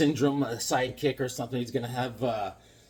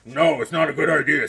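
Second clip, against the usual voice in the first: Batman voice; 7 dB louder than usual. Retold or imitated. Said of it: "Batman says".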